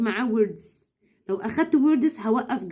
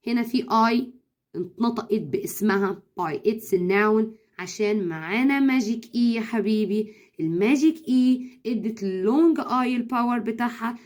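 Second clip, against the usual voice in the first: ordinary talk, nothing marked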